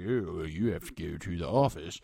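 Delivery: a hint of an authoritative teacher; serious teacher. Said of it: "super serious and authoritative".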